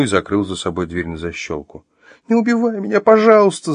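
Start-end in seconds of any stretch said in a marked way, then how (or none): 2.3-3.7 high-pitched, tearful begging; whimpering plea. Acted. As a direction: whimper it, pleading for your life. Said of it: "захныкала она".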